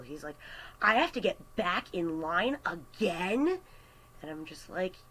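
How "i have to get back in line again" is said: mock-incredulous question, higher pitched; indignant customer. Customer told to wait behind two people, indignant. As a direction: imitate an outraged customer, rising at the end.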